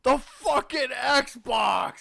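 high, loud, slow shout; panicked realization. Retold or imitated. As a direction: scream the realization out. Imitating the brother's checklist realisation.

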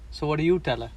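ordinary talk

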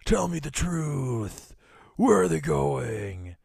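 slow gravelly growl; Batman voice. Retold or imitated. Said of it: "Batman voice continues".

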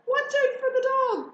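high-pitched loud warning; crying out. A narrator's reading. Sheep warning pig of the spaniel, alarmed cry. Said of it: "cried the sheep".

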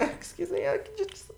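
high timid squeak. Retold or imitated. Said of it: "And I was like".

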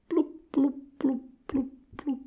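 string of high little bloops; imitated bubble blips. Goldie bubbling between remarks to Kat, high blips. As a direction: blow pretend fish bubbles with your mouth.

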